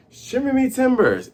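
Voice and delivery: high-pitched mock pirate cry; pirate exclamation. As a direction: exclaim it as a startled crew.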